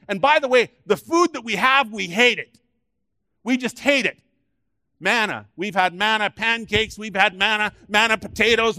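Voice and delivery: loud, high whining gripe; grumbling Israelites. Acted. Israelites complaining to Moses about manna, whining loudly. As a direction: sulk and moan about the food. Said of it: "they began to grumble".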